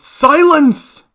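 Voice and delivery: loud, high roar through gritted teeth; one explosive, commanding yell; angry shout. A narrator's reading. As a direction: shout them down in fury. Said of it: "grits his teeth with anger and shouts".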